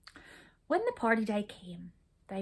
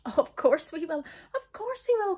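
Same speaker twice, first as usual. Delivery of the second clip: higher, louder eager repeat; eager cry; 8 semitones higher, 6 dB louder than usual. A narrator's reading. Brownies eagerly promise the witch. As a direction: blurt it out eagerly. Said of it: "cried the brownies".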